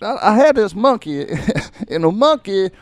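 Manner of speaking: high mock-weepy Richard Pryor voice; imitating Pryor's tearful story. Teller acts out Pryor mourning his monkey, high and mock-tearful. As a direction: pitch way up, playing the crying comedian. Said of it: "and he's, uh, he's like".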